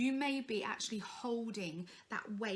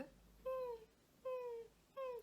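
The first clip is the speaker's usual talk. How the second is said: tiny high whimper; soft, high puppy-like "mm"; puppy dog whimper; 9 dB quieter, much slower than usual. Shown as a demonstration. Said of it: "like a puppy dog sound".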